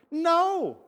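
high, drawn-out no; emphatic mock-indignant refusal; firm refusal. Retold firm refusal of offer.

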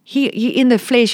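ordinary delivery

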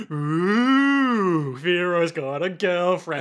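drawn-out playground sing-song; teasing. Retold or imitated. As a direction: tease like a gang of mock schoolkids. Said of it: "all the mobsters are like".

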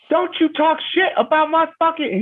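very high mock squeal; hammed-up falsetto rant. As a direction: go way up into a falsetto for the chef. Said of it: "he's doing this high voice thing".

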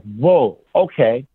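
ordinary talk

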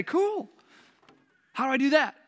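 high-pitched, naive reply; mock eager newcomer. New believers replying to the command, high and naively puzzled.

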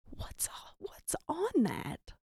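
high, slow, appalled whisper; hushed, stammering mock disbelief; Jesus. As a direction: whisper it haltingly, as if horrified. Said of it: "Jesus is looking down like".

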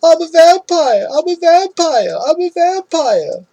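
high, slow, loud frantic yell; wild hammed-up vampire shout in a strange inflection. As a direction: bellow it down the street like a madman. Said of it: "running down the street yelling".

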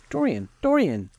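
high, urgent call of the name; pleading high-pitched outcry; cried out. Acted. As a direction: cry out in alarm, pitched well up. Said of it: "he cried".

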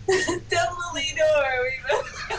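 breaking into laughter mid-word; can't get the name out for laughing. Real, not acted. Reader cracking up mid-sentence.

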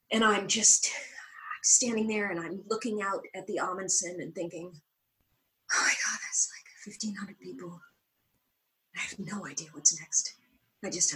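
hushed, unvoiced and frozen; whispered panic. Actress frozen onstage before the audience, whispered panic. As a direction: breathe it out, stunned by the crowd. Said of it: "I have a moment".

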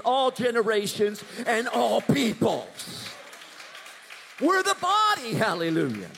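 loud, climbing preacher's cry; full-force preaching. Real, not acted. Preacher building to a shout before the congregation. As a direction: preach it out to the rafters.

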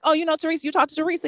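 surprised staff. Retold or imitated. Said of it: "they're like".

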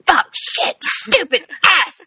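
high-pitched, mock-angry string of curses; frustrated outburst. Host acting out how they curse their own mistakes, mock-exasperated. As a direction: curse in high bursts, ending in a comic wail.

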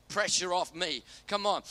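ordinary talk

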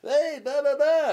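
loud, high gag delivery; mock showman. Comic mimicking his own failing one-liners, mock showman burst. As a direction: punch out filler like a desperate comic.

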